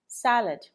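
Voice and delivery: "salad" as SAL-ud, high start then falling; correct first-syllable stress. Shown as a demonstration. Teacher repeating the right form for learners, first-syllable stress on "salad". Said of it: "You're stressing the right syllable".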